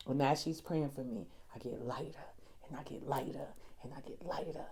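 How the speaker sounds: very soft and low, near a whisper; tender, barely-there testimony; hushed, reverent. Real, not acted. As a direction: let it sink to a hush, as if being prayed over. Hushed retelling of being prayed over.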